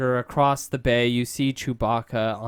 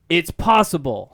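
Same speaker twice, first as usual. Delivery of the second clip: yelling; 4 semitones higher, 7 dB louder than usual. Acted. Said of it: "Han yells".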